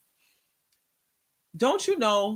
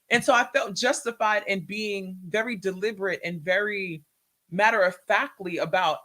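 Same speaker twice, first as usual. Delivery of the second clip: ordinary talk, nothing marked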